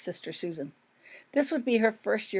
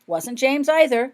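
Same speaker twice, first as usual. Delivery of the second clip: confident; 7 semitones higher, 8 dB louder, slower than usual. A narrator's reading. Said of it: "said Holly confidently".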